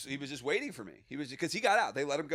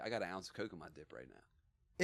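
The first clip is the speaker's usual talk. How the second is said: confiding; 9 semitones lower, 10 dB quieter than usual. Retold or imitated. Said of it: "He's like".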